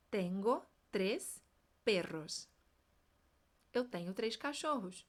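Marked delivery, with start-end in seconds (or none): 0.1-2.5 slow, careful drill, pitch dropping on "perros"; "Tengo tres perros" stretched out, rolled R in "perros"; slow model sentence. Shown as a demonstration. Teacher slowing down for students to repeat. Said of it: "o tres é o R fraco".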